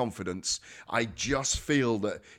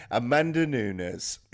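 ordinary delivery

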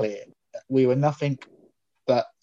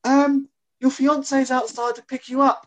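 shouted up; 11 semitones higher than usual. Retold or imitated. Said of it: "his partner from downstairs just shouts up".

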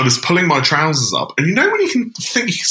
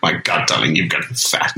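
low, breathy haughty diva; Edna Mode voice; 9 semitones lower than usual. Acted. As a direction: drop low and sneer it like a fashion designer. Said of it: "Edna. Mode".

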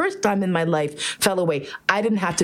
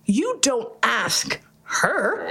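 very slow, drawn-out mock outrage; indignant; 3 semitones higher, much slower than usual. Pony objecting to the petter, slow mock indignation. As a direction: spell it out in offended disbelief.

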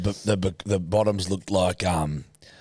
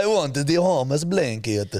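slow, higher fake Italian accent; mock generic accent; 5 semitones higher, slower than usual. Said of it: "generic accent you use for anyone".

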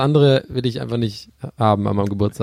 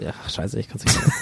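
dropping out of character under the breath; muttered aside; 5 semitones lower, 7 dB quieter than usual. Real, not acted. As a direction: trail off into a whisper. Breaks off the radio bit.

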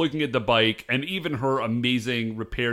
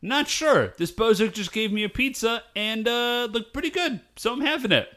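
put-on high, carefree kid voice; a kid happily talking through pizza; 8 semitones higher than usual. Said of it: "And he's like".

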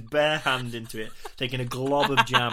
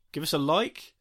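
normal talking voice

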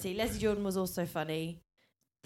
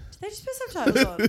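incredulous; 11 semitones higher, 8 dB louder than usual. Retold or imitated. Retelling own reaction to subtitles.

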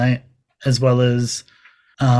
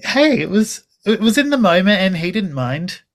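giggly and high, laughing through it; laughing retold quote; 6 semitones higher than usual. Fan retelling her cheeky words to George, laughing. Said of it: "And I said".

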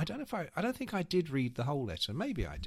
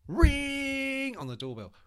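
much higher, louder shouted ring; mock doorbell; 12 semitones higher, 8 dB louder, much slower than usual. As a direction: belt out the ringing doorbell. Imitating the doorbell ringing.

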